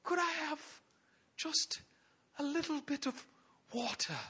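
tiny high, soft, halting voice; mock-feeble begging; timid Elijah. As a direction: squeak out a meek request, word by word. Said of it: "all he could muster the strength to ask".

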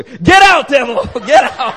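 high, loud rebuke broken by a laugh; commanding shout. Retold or imitated. Preacher switching from thanks to rebuking the demon, shouting. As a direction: bark the command at the devil, half laughing. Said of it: "praying that way".

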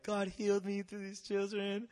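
light retold sob; imitated crying. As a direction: choke it out like a weepy testimony. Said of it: "I started crying, like".

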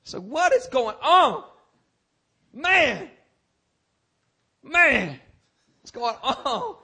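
high, drawn out bewildered cries; venting bewilderment about the week's attacks, high and pleading; perplexed outcry. Acted. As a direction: throw up your hands, baffled. Preacher acting out his perplexity.

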